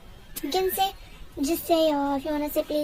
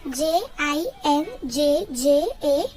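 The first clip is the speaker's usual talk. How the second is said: slow spelling, each letter lifted and separate; spelled out; slower than usual. Shown as a demonstration. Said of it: "teach you how to pronounce it".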